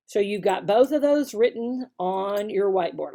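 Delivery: normal talking voice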